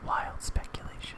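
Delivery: hushed, whispered catchphrase. Pretend. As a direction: whisper it like a mysterious game cue.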